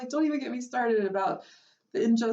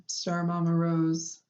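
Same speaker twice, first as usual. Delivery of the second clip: normal talking voice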